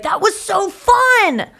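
put-on high, slow, dopey drunk voice; hammed-up drunk gushing; mock drunk. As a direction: slur it out like you're wasted. Imitating herself wasted.

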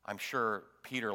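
normal talking voice

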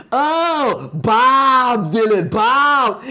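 hammed-up dawning realization, high, with long drawn-out vowels; Meg Ryan. Realizing whom the Frenchman means, Meg Ryan's drawn-out recognition. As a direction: imitate an American finally getting it, slow and high. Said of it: "Meg Ryan looks at him".

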